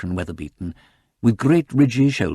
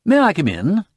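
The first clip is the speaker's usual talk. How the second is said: high, louder courteous request; polite visitor; 11 semitones higher, 6 dB louder than usual. A narrator's reading. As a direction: ask entry with genteel politeness. Visitor politely asks to enter.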